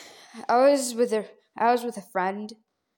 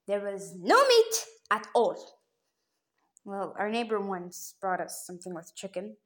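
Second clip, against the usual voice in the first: ordinary talk, nothing marked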